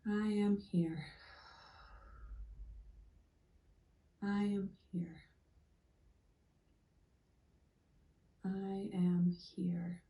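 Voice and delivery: slow, spaced-out mantra with long silences; meditative affirmation. Shown as a demonstration. Guide repeating an affirmation with listeners ten times, slow meditative mantra. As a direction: say each word slowly, settling into the moment.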